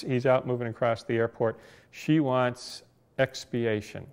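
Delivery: ordinary talk, nothing marked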